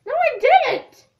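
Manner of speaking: high, loud, drawn-out denial; indignant protest. Real, not acted. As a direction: protest the tease loudly. Denying teasing about boogers.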